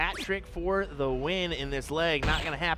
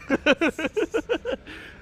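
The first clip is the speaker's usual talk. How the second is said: laughing; 13 semitones higher, 5 dB louder than usual. Real, not acted. Laughing at the story.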